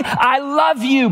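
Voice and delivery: slow, high, drawn-out shout; joyful mock shouting match, father and son; retold shout. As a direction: shout the love back across the room. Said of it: "we are shouting".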